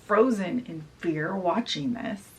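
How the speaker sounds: plain reading voice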